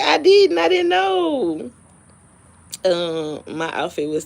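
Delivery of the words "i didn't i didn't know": excited. Retold or imitated. Retold apology when recognizing.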